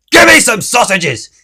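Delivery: loud, high rude demand; snapped. Acted. Mister Grumpy snaps his order at Mister Meat, loud and rude. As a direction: bark the order at the butcher. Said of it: "snapped Mister Grumpy".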